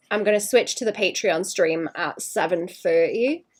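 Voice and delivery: ordinary talk, nothing marked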